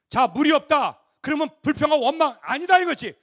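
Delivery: fiery preaching. Acted. Preacher declaring God's way to Israel, fiery. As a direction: drive the point home to the crowd.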